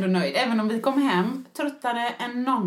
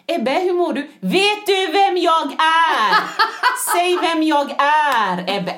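slow, louder, drawn-out questions; mother hovering over her feverish son, pressing him, slow and loud; intense mock questioning; 3 semitones higher, 6 dB louder, much slower than usual. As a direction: lean in and ask the boy slowly and intensely.

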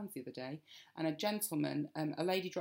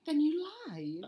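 high, slow, aghast "Then you lied"; half-joking scandalised cry; shocked accusation; 13 semitones higher, slower than usual. Real, not acted. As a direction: gasp out the accusation.

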